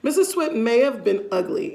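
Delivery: ordinary delivery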